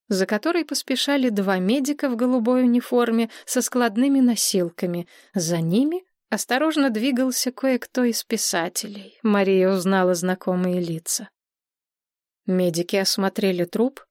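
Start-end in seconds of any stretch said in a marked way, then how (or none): none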